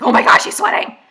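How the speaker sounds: loud, high mock freak-out; flipping out. Heroine seeing him sweaty, mock flipping out. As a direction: blurt it in overblown excitement. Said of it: "starts going, like, flipping out".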